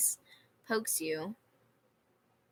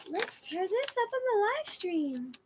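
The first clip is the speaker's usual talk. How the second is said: much higher, bright silly voice; high squeaky take; 12 semitones higher than usual. Shown as a demonstration. Repeating in varied voices.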